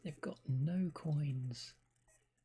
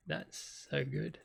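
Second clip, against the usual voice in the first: usual speaking voice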